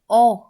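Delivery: ओ said alone, loud and punched; isolated vowel sound. Shown as a demonstration. Teacher answers which sound is heard, loud isolated ओ. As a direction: sound out a forceful open ओ. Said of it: "आवाज़ किसकी आ रही है".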